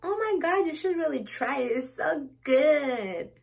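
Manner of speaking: put-on high, gushing valley-girl English; LA girl impression. Mocking an LA girl raving about kombucha, high gushing voice. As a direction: gush like a trendy LA girl selling kombucha. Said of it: "Et elle m'a fait".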